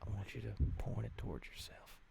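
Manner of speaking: quiet, calm ritual instructions; soft cult leader. Pretend. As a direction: coax him gently toward it. Cult leader gives ritual orders.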